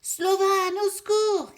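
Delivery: very high, very loud cry. Acted. Kid screaming for the bear while father fights, high and loud. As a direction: scream for help in despair. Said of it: "crie encore Pitou".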